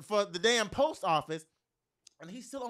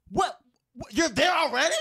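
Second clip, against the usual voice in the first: high, loud disbelieving what; shocked; 11 semitones higher, 12 dB louder than usual. Retold or imitated. To her man on the phone, shocked and loud. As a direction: caught off guard on the phone.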